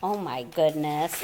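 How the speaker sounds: ordinary delivery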